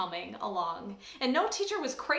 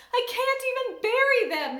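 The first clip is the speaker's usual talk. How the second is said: put-on high, loud wailing sob of a little girl; first grader crying over her dead pet mice; crying and carrying on; 12 semitones higher, 7 dB louder than usual. A narrator's reading. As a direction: wail it through tears. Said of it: "Ramona cried and carried on so".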